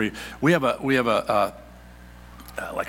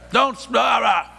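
mock parent scolding; 10 semitones higher, 6 dB louder than usual. Mock scolding kid at table.

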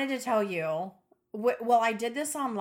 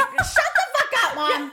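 high, loud comeback through laughter; mock-exasperated yell, pitched way up; mock outburst; 12 semitones higher, 5 dB louder than usual. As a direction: snap back at Mom, hammed up and laughing. Acted retort to own mother.

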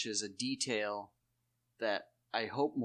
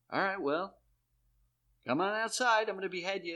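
higher and louder, a hammed-up threat; mock challenge; 9 semitones higher, 4 dB louder than usual. Jon challenging the toady to come outside, hammed-up threat. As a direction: call him outside like an offended lord, half joking.

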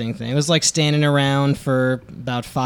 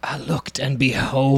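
whisper; slower than usual. Pretend. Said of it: "dramatic label reading".

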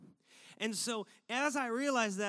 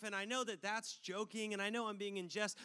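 normal talking voice

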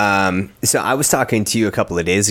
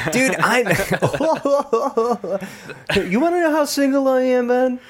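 laughing. Real, not acted. Teased about being single.

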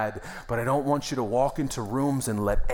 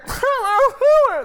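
a sudden high wailing sob, much louder; 11 dB louder than usual. Shown as a demonstration. A hammed-up wail of crying. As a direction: pastor showing the congregation how weirdly he once cried, wailing sob. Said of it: "like that".

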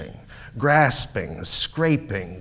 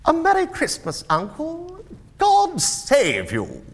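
high, loud cheerful greeting; cheerful nephew; 5 dB louder than usual. A narrator's reading. Nephew greets his uncle at Christmas, cheerful. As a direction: call out high and merrily. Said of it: "cried a cheerful voice".

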